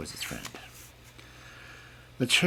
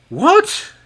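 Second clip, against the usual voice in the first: much higher, loud furious what; angry cry; 11 dB louder than usual. A narrator's reading. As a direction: shout in disbelief. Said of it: "cried the king angrily".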